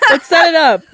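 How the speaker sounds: laughing through words. Real, not acted. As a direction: giggle while saying it. Laughing suggestion.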